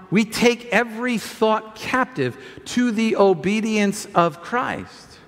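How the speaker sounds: slow, weighty scripture quote; deliberate recitation. Real, not acted. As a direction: spell the verse out phrase by phrase, slowly. Repeats verse slowly for emphasis.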